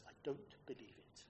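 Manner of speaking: a grumpy exasperated catchphrase, whispered low; a light nod to a disbelieving grump; Victor Meldrew. Retold or imitated. Preacher quoting the elder son, a whispered Meldrew grumble. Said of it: "In true Victor Meldrew terms".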